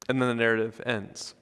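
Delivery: usual speaking voice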